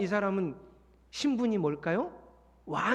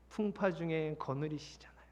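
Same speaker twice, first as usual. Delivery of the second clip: soft whisper of reassurance; 3 semitones lower, 6 dB quieter than usual. Acted. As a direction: whisper it gently, like a secret comfort. (속삭이듯 조용히 위로하며)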